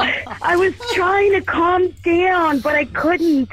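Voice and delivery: high, drawn-out excited gush; too worked up to calm down; super excited. Real, not acted. Fan admitting she couldn't calm down, excited. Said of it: "I'm super excited".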